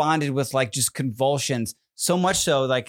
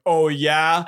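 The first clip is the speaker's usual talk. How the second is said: mock skeptical. Husband mimics wife's dismissive reply.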